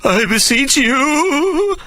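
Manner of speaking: very high, very slow plea; beseeching. A narrator's reading. Sir Jasper beseeching Hannah, very high slow plea. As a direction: stretch out the plea in despair.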